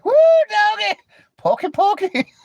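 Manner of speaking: high, loud hammed-up whoop; squealing at a hot guy; mock excited. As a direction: gush like a crazed fan. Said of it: "I'd be like".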